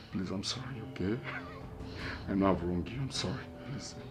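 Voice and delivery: fading plea. Acted. Husband begging his wife, fading into whispered apologies. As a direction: plead until the voice almost disappears.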